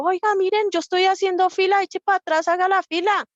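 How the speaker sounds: high, loud demand to get in line; scolding shout. Retold or imitated. Preacher voicing a scolded line-cutter, loud and high. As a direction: yell at the line-cutter to go back. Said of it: "no le dijo".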